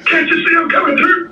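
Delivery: put-on high, fast cranky old man. Shown as a demonstration. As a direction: complain like a crotchety very old man. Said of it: "the old man voice".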